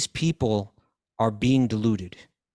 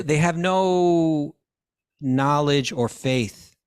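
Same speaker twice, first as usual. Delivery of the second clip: they have no knowledge or faith drawn out slow, no pitched higher and louder; slow emphasis; 4 semitones higher, 3 dB louder, much slower than usual. Real, not acted. Explaining to a friend that they have no faith, slow stressed emphasis. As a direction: lean hard into each word, slowly. Explaining people without scripture.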